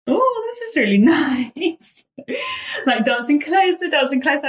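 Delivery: giddy giggle while reliving the moment; giggling. Retold or imitated. Recalls dancing closer, giggling.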